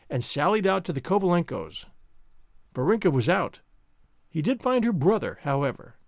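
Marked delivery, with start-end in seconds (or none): none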